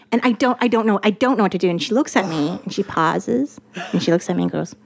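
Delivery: ordinary delivery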